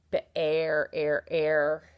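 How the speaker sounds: "Bear, air, air" stretched, stressing the "air" sound; sound isolated. Shown as a demonstration. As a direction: pull out the "air" so it can be heard. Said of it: "There it sounds to me".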